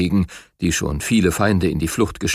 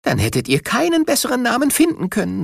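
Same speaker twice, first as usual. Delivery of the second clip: put-on much higher, bright young tiger; young cub voice; 14 semitones higher, faster than usual. A narrator's reading. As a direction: answer with an admiring cub's voice. Cub answers the tiger king.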